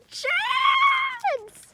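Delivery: very high, loud held call of the name; shout. Pretend. Kid shouting for her pet to come back. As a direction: yell the name across the yard.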